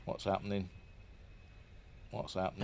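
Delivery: very soft, low-pitched questioning; hushed concern. Real, not acted. Spectator puzzled by turning rocket, hushed concern. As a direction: ask it almost to yourself. Watching the flight go wrong.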